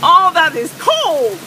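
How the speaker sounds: high yelps from the cold rain; shrieking at the cold. Real, not acted. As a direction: squeal at the icy water.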